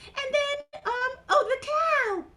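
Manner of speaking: high, hesitant puppet voice, then triumphant; Mimi. Acted. Mimi tries to remember the animals, high puppet voice. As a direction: fumble as a tiny character, then blurt it.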